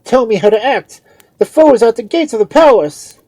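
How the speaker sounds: high, very loud cut-off; frantic priest demanding haste; impatient cry. A narrator's reading. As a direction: shout it impatiently. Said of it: "cried the priest impatiently".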